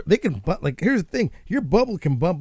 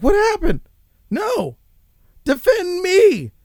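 much higher, mock panicked squeal; whiny plea; 4 dB louder, slower than usual. Vlogger mocks Don Lemon pleading for defense, high mock whine. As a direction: squeak it out like a cornered host begging.

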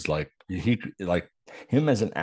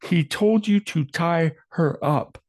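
high, slow, louder exasperated yell; yelling at the screen; 10 semitones higher, 5 dB louder, slower than usual. Real, not acted. Viewer yells at the screen, slow high exasperation. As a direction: shout it at the TV in disbelief.

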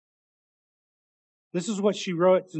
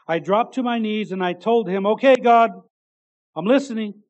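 loud high cry of surrender; fervent cry; 3 semitones higher, 10 dB louder than usual. Retold or imitated. Preacher voices niece crying out to God, loud and high. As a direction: cry out Okay, God like a prayer.

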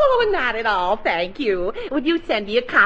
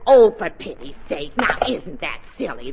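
ordinary delivery

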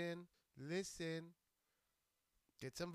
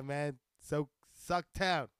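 ordinary talk

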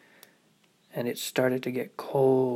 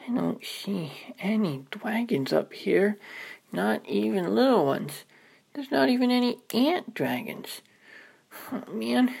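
put-on little girl voice; 8 semitones higher than usual. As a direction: murmur like a small child looking around. Ruby at treetop.

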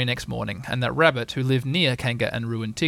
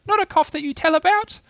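very high squeaky little-creature voice; tiny, piping protest; Roo; 5 dB louder than usual. Acted. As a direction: squeak an excuse like a small child. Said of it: "said Roo".

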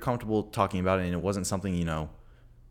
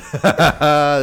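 high, loud belly laugh; loud burst of laughter; 8 semitones higher, 13 dB louder than usual. Real, not acted. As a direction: crack up at your own failed bit. Abandoned accent cracks him up.

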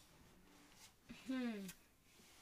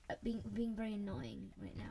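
whispering; 4 semitones lower, 11 dB quieter than usual. Real, not acted. Whispered complaint about co-producer.

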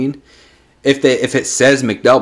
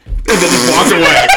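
ordinary talk, nothing marked

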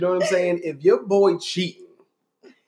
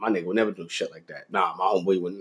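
lower, softer denial; loyal friend covering; 9 semitones lower, 8 dB quieter than usual. Retold or imitated. Friend lying to a girlfriend for his boy, low and soft. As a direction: cover for your boy, deadpan. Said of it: "ride that lie out for me".

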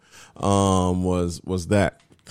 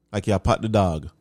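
normal talking voice

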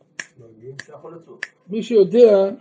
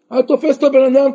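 ordinary delivery

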